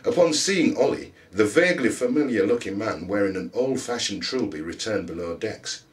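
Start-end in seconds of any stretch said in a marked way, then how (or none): none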